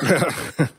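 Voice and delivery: short dry laugh; smug little chuckle; knowing chuckle. Acted. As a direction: laugh at their naivety. Stranger amused at their relief.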